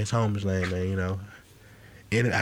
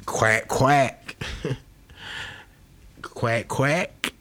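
duck voice; 3 semitones higher, much slower than usual. Retold or imitated. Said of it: "You know, he like".

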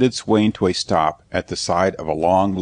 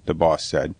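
ordinary delivery

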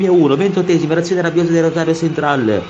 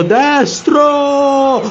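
goal-call shout; 13 semitones higher, 4 dB louder than usual. Acted. Commentator calls a shot.

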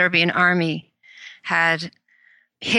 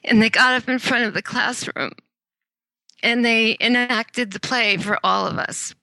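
teary; 4 semitones higher than usual. Real, not acted. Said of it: "I'm getting teary".

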